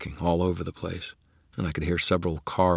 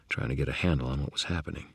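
plain reading voice

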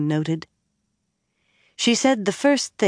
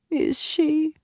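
tipsy challenge; 12 semitones higher than usual. A narrator's reading. Elaine challenges.

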